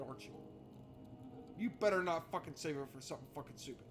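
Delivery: ordinary talk, nothing marked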